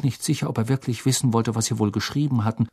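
plain reading voice

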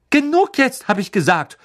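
mother snapping at her teasing son; snapping at him. A narrator's reading. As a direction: cut him off like a fed-up mum. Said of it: "herrschte ihn Misses Weasley an".

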